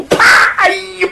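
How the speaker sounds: high, fast shout of pain; pained yelp. Acted. Bandit struck in the face, yelping high in pain. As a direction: yelp as the pellet strikes.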